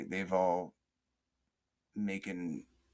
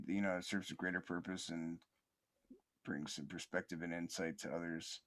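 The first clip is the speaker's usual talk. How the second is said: normal talking voice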